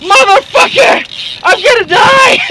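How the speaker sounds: strained shout. Real, not acted. Exhausted fighting the fish.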